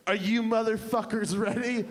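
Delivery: arrogant. Acted. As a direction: hype the room with mock arrogance. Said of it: "this arrogant woman".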